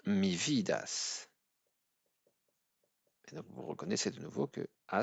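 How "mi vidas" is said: mi vidas said slowly, stress on vi; teacher demonstrating mi vidas slowly; slow demo. Shown as a demonstration. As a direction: model mi vidas for beginners. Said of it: "Si je dis par exemple".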